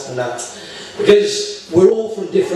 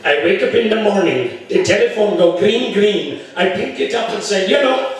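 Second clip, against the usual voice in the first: put-on lilting Indian accent building to the punchline; 3 semitones higher than usual. Indian applicant answering the interviewer's colour test, Indian accent. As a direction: joke Indian man's accent. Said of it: "The Indian says".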